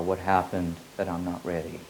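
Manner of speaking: ordinary talk, nothing marked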